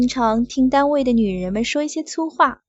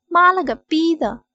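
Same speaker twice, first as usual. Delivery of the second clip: angry curse; 6 semitones higher, 5 dB louder than usual. Acted. Angry boss swearing at woman.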